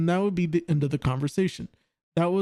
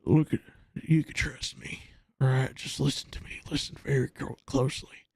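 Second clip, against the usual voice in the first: hushed wrestling-promo whisper; low, confiding whisper that demands close listening; Jake the Snake Roberts; 3 semitones lower, 3 dB quieter than usual. Acted. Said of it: "when he gets to a whisper".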